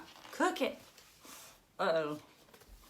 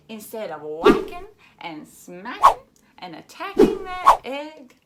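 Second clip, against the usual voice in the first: loud, hammered whacking, smacking, attacking; punchy loud stress; 5 semitones higher, 13 dB louder than usual. Acted. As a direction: hit each rhyme word hard. Stressing the violent words.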